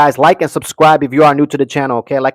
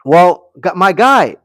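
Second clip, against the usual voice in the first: usual speaking voice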